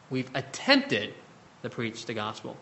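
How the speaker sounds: attempted drawn out slowly, then trailing soft; stress on attempted. Shown as a demonstration. Preacher pressing the congregation, stress on attempted. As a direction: lean on attempted and let the rest fall away. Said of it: "I stress attempted".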